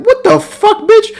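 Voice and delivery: very high-pitched incredulous outburst; mock disbelief. Player reacting to Judy's antics on the couch, mock disbelief. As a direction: squeal it in exaggerated bafflement. Acted baffled reaction to Judy.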